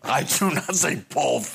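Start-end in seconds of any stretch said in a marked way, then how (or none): 1.1-1.5 BOTH said as "bolth"; intrusive L. Shown as a demonstration. Speaker denies saying it to friend, quoting bolth. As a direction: put an L before the th in both. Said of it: "There's no L in both".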